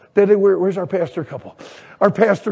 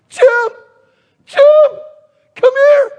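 high, loud imitated wife's shout; urgent yell; 8 dB louder, slower than usual. Wife to husband signing the Jeep papers, urgent yell. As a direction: call urgently at a high pitch. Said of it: "and she yells".

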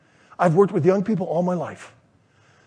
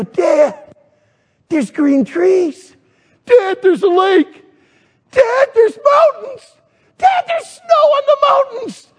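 put-on high-pitched kids' shouts, building louder; amazed boys; 14 semitones higher, 5 dB louder than usual. Sons to dad looking out the tent, mounting amazement. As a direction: climb higher with each new discovery.

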